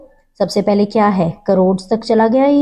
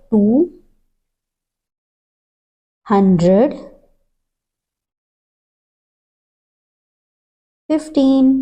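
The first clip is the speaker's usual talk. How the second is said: slow dictation; 3 semitones higher, slower than usual. Shown as a demonstration. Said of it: "teacher dictates slowly, word by word".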